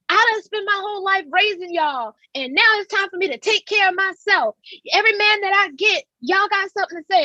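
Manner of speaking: high, aggrieved mother voice; mama complaining. Retold or imitated. Mother defending her boyfriend, high and aggrieved. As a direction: whine that it's her turn now. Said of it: "then she's like".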